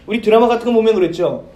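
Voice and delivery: ordinary talk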